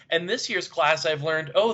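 normal talking voice

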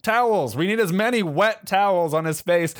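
put-on high, bossy old lady fussing; fussy old lady. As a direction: fuss over him like a worried grandma. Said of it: "She's like".

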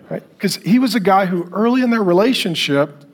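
usual speaking voice

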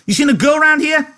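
very high, loud snarling question; angry demand. A narrator's reading. Curly bursting in hunting for a girl, angry demand. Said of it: "he demanded angrily".

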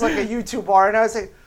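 ordinary delivery

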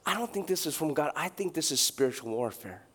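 quiet realization. Retold or imitated. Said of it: "he realized".

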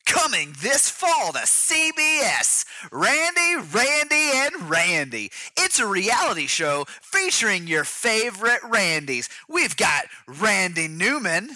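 high, drawn-out announcer hype; mock TV promo announcer. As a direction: sell the new show with big energy.

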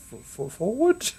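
halting mock bewilderment, voice climbing at the end; confused questioning. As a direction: stammer into a rising, high-pitched question. Jokingly unsure which way clocks went.

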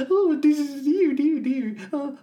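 very high, anxious little piglet; fretful tiny piglet fussing to Pooh. Retold or imitated.

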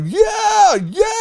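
high-pitched excited cheering; retold yelling cheer. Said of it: "I'm yelling her name".

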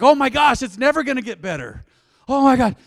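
loud, high mock wail; hammed-up despair. Preacher mimics a tired person's melodrama, loud mock despair. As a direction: overplay the doom like the world's ending. Mocking world-ending despair after poor sleep.

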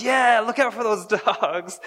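high, loud cheering crowd with a laugh; loud, fast chant of agreement; excited crowd. Pretend. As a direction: hype it up like a fired-up congregation. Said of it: "everyone's like".

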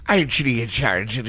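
quick, garbled Irish gibberish; hammed-up Irish that slides toward pirate; mock Irish accent. Shown as a demonstration. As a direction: rattle off a badly faked Irish accent. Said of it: "my Irish go-to is just go".

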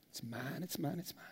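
quiet, low possessive whine; possessive whining. Retold or imitated. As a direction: clutch it like a child, softly. Mocking greedy hoarder.

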